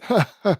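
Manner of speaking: short high wheezy cackle; a miser chuckling at himself; short high laugh; old man's cackle. A narrator's reading. Old man cackles after speech.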